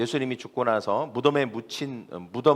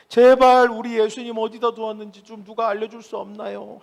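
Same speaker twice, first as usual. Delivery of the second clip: high, sustained wailing plea; distraught self-talk; 7 semitones higher, 4 dB louder than usual. Acted. Preacher acts Mary begging to know where Jesus is, distraught lament. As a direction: beg aloud in a long lamenting chant, acting out despair. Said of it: "미친 사람처럼 막 혼잣말을".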